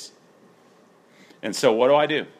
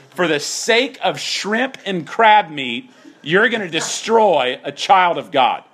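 louder, slow mock disbelief; sarcastic incredulity; 8 semitones higher, 5 dB louder, slower than usual. Preacher spelling out Paul's sarcasm to the church, louder. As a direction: lay the sarcasm on thick and slow. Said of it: "Did you catch the rich sarcasm in that".

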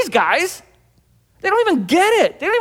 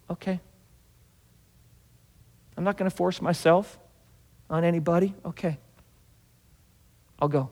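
gentle Jesus; 6 semitones lower, 6 dB quieter than usual. Retold or imitated. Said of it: "And you know what Jesus did?".

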